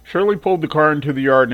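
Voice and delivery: ordinary delivery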